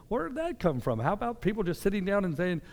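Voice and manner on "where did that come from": a bewildered, high rhetorical question; baffled disbelief. Retold or imitated. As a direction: ask it as if you can't believe what you just heard. Baffled at hateful reaction.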